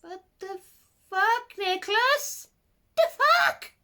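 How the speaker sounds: high, loud, shocked shriek at the author; mock-horrified cry; shocked outcry. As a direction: scream it in horrified disbelief. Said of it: "it was like".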